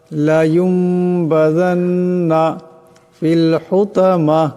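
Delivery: slow melodic tajweed chant, drawn-out vowels; measured, melodic Quranic recitation. Shown as a demonstration. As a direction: recite it slowly as a tajweed lesson. Teacher recites the verses again.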